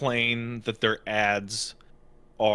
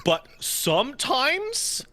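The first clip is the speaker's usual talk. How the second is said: high exasperated; 12 semitones higher, slower than usual. Real, not acted.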